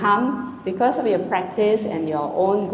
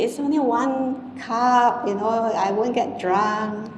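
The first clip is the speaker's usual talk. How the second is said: higher, slow, wheedling put-on voice with "only" stretched; mocking the excuse-makers; 4 semitones higher, slower than usual. Monk mimics people excusing a drink, mock wheedling. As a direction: play the pleading drinker, slowly. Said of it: "people say".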